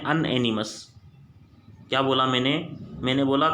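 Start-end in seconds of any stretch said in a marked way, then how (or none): 0.0-0.9 अनएनिमस said as "an-enimus", first syllable "un"; common mispronunciation. Shown as a demonstration. Teacher showing students the wrong way to say it, "an-enimus". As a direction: show the wrong way, अनएनिमस starting with "an". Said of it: "इसको कई लोग पढ़ते हैं".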